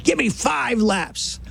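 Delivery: coach screaming. Retold or imitated. Coach screams at a late eight-year-old, slow shouted orders. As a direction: bellow it at a late kid fifty yards off.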